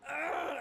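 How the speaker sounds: short high-pitched yelp; cartoonish startled "ah!"; mock scream. Friends fleeing a gross man, mock scream. Friends recoil and run away.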